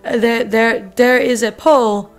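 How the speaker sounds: slow drifting mock accent, German or Russian sliding into robotic French; deliberately slow, mixing German, Russian and robotic French sounds; made-up foreign accent. Opening the vlog in a mystery made-up accent, slow and mock-serious. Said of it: "I don't even know what accent one that was".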